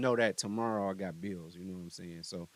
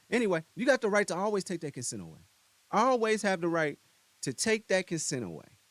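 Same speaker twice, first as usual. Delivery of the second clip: louder, higher, pressing the point; emphatic insistence; 7 semitones higher, 5 dB louder than usual. Real, not acted. Vlogger insisting to viewers that consent can be taken back. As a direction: hammer home the right to withdraw.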